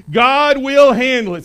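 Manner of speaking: loud, slow, every word weighted; emphatic declaration. Shown as a demonstration. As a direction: proclaim it for the church to echo. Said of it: "Say it back to me".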